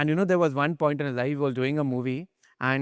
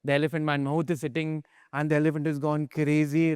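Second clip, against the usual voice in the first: ordinary talk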